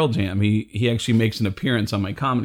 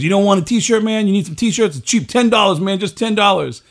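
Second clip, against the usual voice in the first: higher, louder sales pitch; pushy street hawker; 10 semitones higher, 4 dB louder than usual. Retold or imitated. Street seller pitching John a counterfeit T-shirt, pushy hawker. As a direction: push the bargain like a street vendor.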